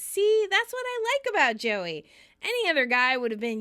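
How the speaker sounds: high, loud delighted quote; thrilled. Retold or imitated. Kathy is thrilled by Joey's movie choice, high and delighted. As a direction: imitate bubbling approval. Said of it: "who is thrilled".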